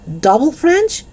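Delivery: incredulous, high-pitched "Double French?"; appalled echo. A narrator's reading. Dennis echoes news in dismay.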